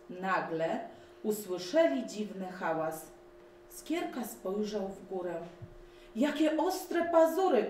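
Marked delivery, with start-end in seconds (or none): 6.1-7.7 much higher, louder alarmed cry; frightened cry. A narrator's reading. Elf spotting sharp claws above, alarmed cry. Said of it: "krzyknął".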